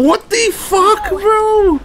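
ordinary talk, nothing marked

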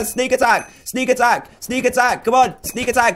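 high-pitched, repeated attack shouts; excited battle call. Real, not acted. Gamer springing an attack on an opponent, excited battle call. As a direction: call it out high, each one falling. Calling out his attack in game.

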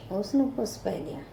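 ordinary delivery, nothing marked

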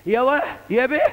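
high Sa'idi exclamation, imitating the old priest; Upper Egyptian accent. Preacher imitating old Sa'idi priest, Upper Egyptian accent. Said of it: "اسم الصليب كله صعيدي".

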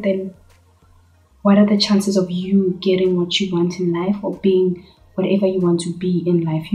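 ordinary delivery

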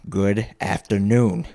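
very slow, emphatic farewell; stonewalling dismissal. A narrator's reading. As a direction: shut the plea down word by word. Scrooge stonewalls the plea.

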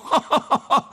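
high whinnying laugh; horse laughter. Acted.

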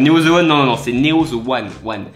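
Néo The One avec un accent anglais appuyé. Shown as a demonstration. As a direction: dire « The One » à l'anglaise, « wan ». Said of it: "Accent anglais, please". (avec l'accent anglais, s'il vous plaît)

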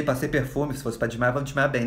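ordinary delivery

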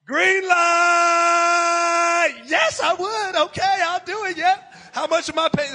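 high, loud, triumphant yes; overjoyed outburst; joyful shout. Retold or imitated. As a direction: shout it like you just won. Retelling his joyful acceptance.

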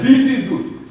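put-on high-pitched African accent. Optimistic friend's catchphrase, African accent. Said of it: "in your best African accent".

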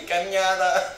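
high, giggling Canada with the wavy N; amused giggling repeat; laughing through the word. Real, not acted. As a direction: laugh it out while saying it.

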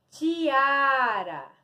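stretched teacher's model word; drawn-out word reveal. Shown as a demonstration. Teacher revealing a word to pupils, drawn out. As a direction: present it clearly, drawing out "tiara".

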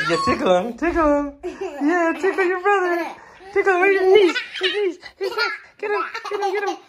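high, loud, laughing cheers. Real, not acted. Mother cheering baby tickling brother.

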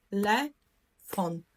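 slow, split into syllables: те-ле-фон; по складах. Shown as a demonstration. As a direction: say Телефон in three separate syllables. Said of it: "ділити слово на склади". (повільно, по складах: те-ле-фон)

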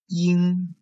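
音 held high, loud and level; tone drill. Shown as a demonstration. Teacher reading for students to circle, 音 high and level. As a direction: sustain 音 on a high first tone. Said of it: "focus on the tones".

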